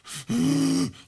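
sharp, high gasp; imitated gasp. Preacher imitating a possessed man brought into church, gasping. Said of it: "like that there".

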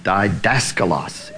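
didaskalos said slowly and low, syllable by syllable; didaskalos, slow careful Greek pronunciation; Greek word. Shown as a demonstration. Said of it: "The word for master is".

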